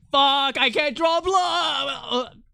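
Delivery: high, drawn-out frustrated shout; frustrated wail. Real, not acted. Frustrated outburst while drawing.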